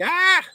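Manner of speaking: sudden high yelp of fright; startled yelp. Retold or imitated. Man at his computer startled by trespassing deputy, high yelp. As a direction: jump and cry out, caught off guard. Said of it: "I'm fucking startled".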